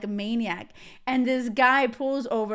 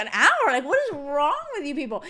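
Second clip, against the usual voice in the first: high, louder burst of disbelief; mock outrage at the driver; exasperated retort, pitched up at first; indignant; 4 semitones higher, 4 dB louder than usual. Acted outraged reply to driver.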